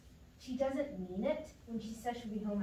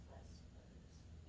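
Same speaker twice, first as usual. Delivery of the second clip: fading whisper; 17 dB quieter than usual. Acted. Tired kid trailing off at 3 AM.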